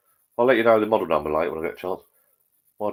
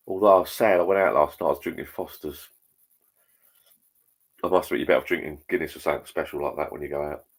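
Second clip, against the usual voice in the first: usual speaking voice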